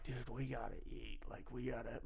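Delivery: low, very soft aside; body pleading. Retold or imitated. Body telling its owner to eat, low and hushed. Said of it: "your body's telling you".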